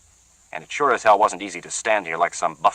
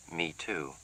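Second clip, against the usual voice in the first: slow and soft; 15 dB quieter than usual. Acted. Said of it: "Celia said it very slowly".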